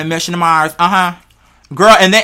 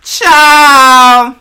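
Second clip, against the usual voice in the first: loud exclamation; 5 semitones higher, 7 dB louder than usual. Real, not acted. Exclaiming after a laugh.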